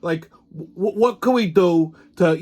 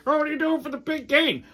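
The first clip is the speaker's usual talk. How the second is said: high-pitched mock eager question; mocking big-game fan; 9 semitones higher than usual. Mimicking people who hype the big game, mock excitement. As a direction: parrot an excited fan in a raised voice. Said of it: "when people refer".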